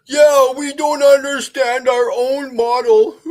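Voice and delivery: squeaky caricature of a globe believer; mocking globie voice. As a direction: parody the other side as clueless.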